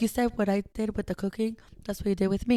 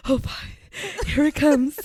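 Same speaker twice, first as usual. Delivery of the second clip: mock dread; 10 semitones higher, 10 dB louder than usual. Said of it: "I was like".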